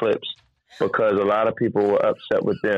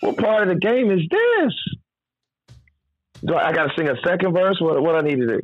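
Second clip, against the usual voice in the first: mock disbelief; 7 semitones higher than usual. Incredulous retelling.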